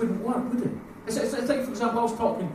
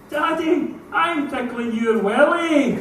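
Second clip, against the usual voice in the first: put-on higher, louder, slow toddler announcement; loud child voice; 8 semitones higher, 7 dB louder, much slower than usual. Daughter announcing to the changing room, loud high slow. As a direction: announce it loudly like a proud kid. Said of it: "in a voice slightly louder than I would have liked".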